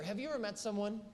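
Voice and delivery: ordinary talk, nothing marked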